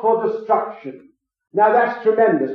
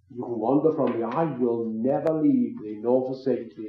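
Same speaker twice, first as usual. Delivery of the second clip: much softer and lower, tender and reassuring; gentle divine promise; 7 semitones lower, 10 dB quieter than usual. Acted. God gently reassuring the one who wanders, softly and low. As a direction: speak it low and soft, like God comforting a wanderer.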